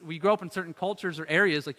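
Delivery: normal talking voice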